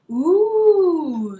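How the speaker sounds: high, loud, quavering ooh; children thrilled by a curse; spooked ooh. Acted. Crocodile class hears about bad luck, high loud ooh. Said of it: "said all the little crocodiles".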